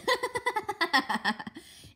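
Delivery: high, loud burst of laughter; mocking laugh. Sister bursts out laughing at her, high and loud.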